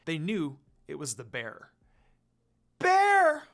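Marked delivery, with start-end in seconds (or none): 2.8-3.5 shrill terrified scream, much higher and louder; scream in horror. Acted. Rin spotting the demon bear, a horrified scream. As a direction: shriek it at the bear. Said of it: "Rin screamed in horror".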